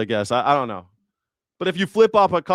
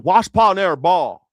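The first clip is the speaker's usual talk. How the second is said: put-on ex-father-in-law's Virginia accent; 3 semitones higher, 6 dB louder than usual. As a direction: swallow the consonants in a mock Virginia twang. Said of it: "he said this".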